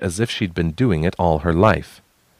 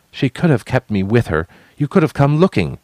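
angry accusation; 7 semitones higher, 4 dB louder than usual. Acted. Responsibility's rising anger at brother.

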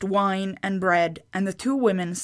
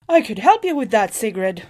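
mischievous sorceress; 2 semitones higher, 4 dB louder than usual. A narrator's reading. Groa's mischievous offer.

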